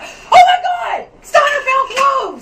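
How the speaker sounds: very high, loud, slow triumphant yell; excited shout. Real, not acted. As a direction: shout the good news to the house. Cloves finally found.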